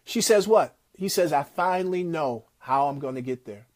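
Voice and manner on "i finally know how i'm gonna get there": choked-up retelling. Retold or imitated. Steve Harvey, crying on the ride home, quietly says it lower and softer. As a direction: lower and much softer, a hint of tears in a retold line. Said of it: "and he's crying".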